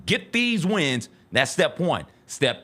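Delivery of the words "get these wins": ordinary talk, nothing marked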